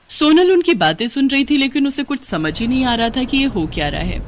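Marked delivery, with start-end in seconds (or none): none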